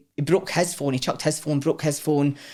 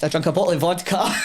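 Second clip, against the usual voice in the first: laughing through it, pitched up; 5 semitones higher than usual. Real, not acted. Telling the interviewer about the vodka, laughing. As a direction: giggle while admitting the bottle of vodka. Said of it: "laughing recalling vodka".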